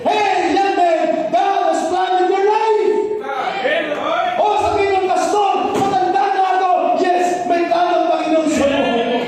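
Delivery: high, loud sung-out preaching; fervent preaching. Acted. Pastor exhorts the church members, fervent preaching. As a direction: preach with rising fervour.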